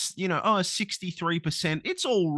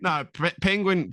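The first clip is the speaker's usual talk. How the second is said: ordinary talk, nothing marked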